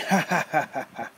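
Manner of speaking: loud, high cackling laugh; old man laughing at the nervous boy, loud cackle; rough laugh. Acted. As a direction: let a mocking cackle out. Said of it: "he started laughing".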